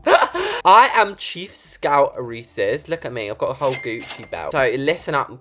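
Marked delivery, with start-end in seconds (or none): 0.1-2.9 grand title squeezed out through laughter, slow; laughing. Real, not acted. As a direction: announce it while cracking up. Laughing at her own title.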